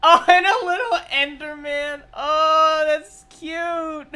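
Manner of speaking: high, loud cooing excitement; delighted squeal. Real, not acted. Fan seeing a little Enderman, delighted squeal. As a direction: squeal at something adorable.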